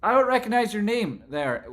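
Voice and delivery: high, loud, overexcited cheer; hyped shout. Real, not acted. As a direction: hype them up at full volume. Hyped greeting of a viewer.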